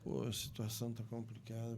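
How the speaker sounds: very soft, low, downcast voice; defeated. Acted. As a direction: sound beaten, barely putting voice into it. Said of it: "tão derrotada". (derrotado, voz baixa e abatida)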